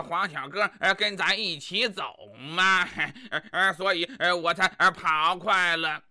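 low, halting, full of er-er; stammering dullard husband. Acted. Husband making excuses to his wife, low halting stammer.

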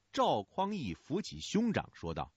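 usual speaking voice

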